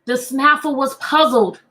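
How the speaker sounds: lightly voiced rhyming storybook read; reading to children. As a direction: read the rhyme to a class, unhurried. Reads the picture book aloud.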